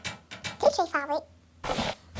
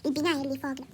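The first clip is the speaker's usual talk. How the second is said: usual speaking voice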